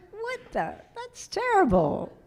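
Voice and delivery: high, shocked disbelief, re-enacted; shocked outrage. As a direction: squeal out the shock of reading it. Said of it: "I was like".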